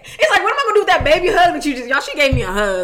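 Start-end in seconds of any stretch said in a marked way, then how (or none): 0.4-1.9 high, loud, quick mock protest; mock exasperation. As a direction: protest it fast with laughing disbelief. Acts out baffled reaction to a hug.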